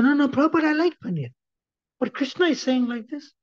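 high-pitched, eager voice of a disciple; protesting disciple. Retold or imitated. Disciple answering his guru's question, high-pitched protest.

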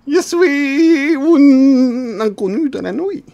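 high, very slow drawn-out stranger voice; mysterious stranger. Acted. Character introducing himself to the lost man, slow and high.

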